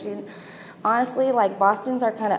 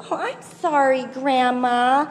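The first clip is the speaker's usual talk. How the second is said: put-on higher, childish voice getting sharper at the end; little girl imitation; 5 semitones higher, 4 dB louder than usual. Little girl apologizes to grandma then snaps at mom, higher childish voice. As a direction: mimic a bratty four-year-old talking back. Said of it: "I notice the little girl go".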